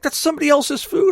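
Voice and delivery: high, loud disbelief; appalled objection. Retold or imitated. Brother objects to eating leftovers.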